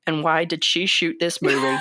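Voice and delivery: low cowboy impression with a slipping accent; Sam Elliott. Co-host resuming the actor's rant, low impression with drifting accent. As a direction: keep the actor's deep voice going, accent wobbling. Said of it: "let me do my Sam Elliott impression".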